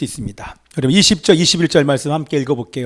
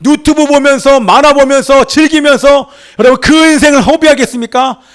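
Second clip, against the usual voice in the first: loud, high fervent preaching; preaching at full force; 8 semitones higher, 4 dB louder than usual. Acted. Preacher rebuking the congregation, loud and fervent. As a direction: drive the rebuke home to the pews.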